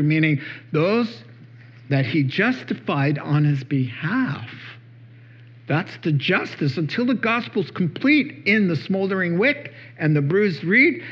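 normal talking voice

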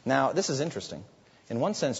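ordinary delivery

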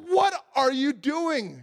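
high, slow, incredulous; husband catching wife with coffee, exasperated; exasperated disbelief. Retold or imitated. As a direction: draw it out in disbelief. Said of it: "And I'm like".

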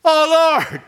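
loud, high-pitched cry to God. Acted. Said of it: "he cries out".

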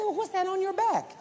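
high, startled friend calling out; surprised friend. Retold or imitated. Billy Joe asks Brother Fred about his load, high and startled. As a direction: play Billy Joe catching sight of the load.

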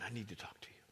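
hushed, intimate whisper to God; whispered, pleading for a moment with the Lord; whispered prayer. Acted. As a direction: breathe it as a private plea.

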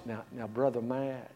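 softly proper, gently correcting; very polite and proper. Retold or imitated. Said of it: "very polite, very proper".

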